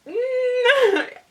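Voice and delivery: high, loud wordless squeal; cringing squeal. Retold or imitated. Storyteller reliving the most awkward moment, high cringing squeal. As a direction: squeal with embarrassment. Cringing after retold apology.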